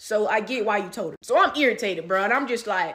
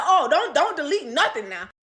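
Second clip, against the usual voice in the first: higher and louder, threatening; warning; 5 semitones higher, 5 dB louder than usual. Retold or imitated. Warning the boyfriend not to delete messages, loud and higher. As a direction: stop him cold. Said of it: "So I'm like".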